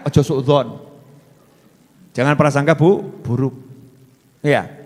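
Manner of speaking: normal talking voice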